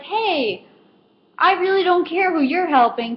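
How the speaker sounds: high-pitched mock pushy shopper; entitled customer. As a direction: imitate a customer butting in. Acted entitled customer demanding help.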